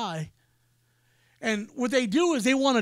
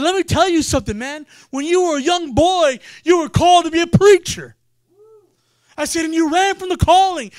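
loud, forceful prophetic declaration; prophesying; 4 semitones higher, 10 dB louder than usual. Retold or imitated. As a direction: proclaim it over him like a preacher on fire. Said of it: "I began to prophesy a word".

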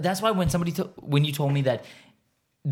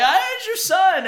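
very high, squawked protest; mock indignant; 4 dB louder than usual. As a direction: squeal the comeback in mock outrage. Acted indignant reply in retold scene.

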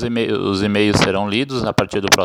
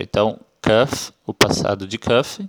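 ordinary delivery

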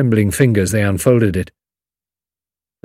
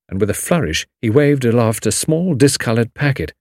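ordinary delivery

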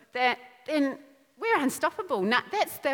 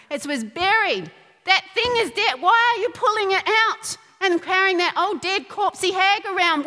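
higher, louder preaching push; fiery preaching; 4 semitones higher, 4 dB louder than usual. Acted. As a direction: preach it hard at the congregation. Preaching at full force.

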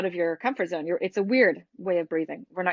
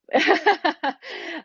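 a high, bursting real laugh; bright burst of laughter; 10 semitones higher than usual. Real, not acted. Laughing at own imperfection.

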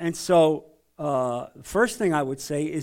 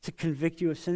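softer, quiet and caring questions; tender, sincere invitation; loving, gentle; 4 semitones lower, 7 dB quieter than usual. Shown as a demonstration. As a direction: ask gently, as if to a friend you care for. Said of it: "when you talk like that in a loving way".

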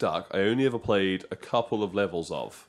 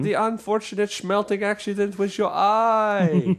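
high, slow hammy villain; lightly voiced melodramatic villain; 9 semitones higher, 4 dB louder than usual. Mock villain line about Goldfinger.

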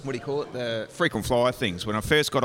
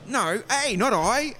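much higher, mocking voice of the agent; 13 semitones higher, slower than usual. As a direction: correct him in a high squeaky voice. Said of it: "She said".

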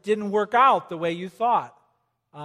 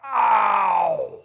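loud mock cry of pain; hammed-up pained yelp; 5 dB louder than usual. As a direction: yelp like you just hit the ground. Acting out a painful fall.

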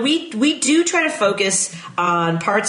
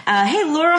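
normal talking voice

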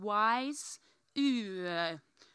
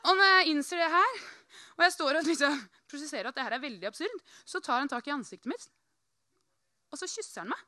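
ordinary delivery

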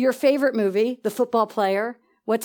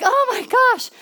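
much higher, thrilled squeal; excited exclamation; 10 semitones higher, slower than usual. Retold or imitated. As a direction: burst out in delight. Retold excited gasp.

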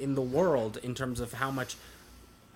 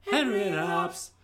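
silly voice; 6 semitones higher, 5 dB louder than usual. Pretend. Repeating show name in voices.

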